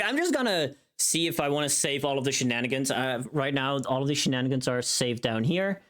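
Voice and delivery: ordinary talk, nothing marked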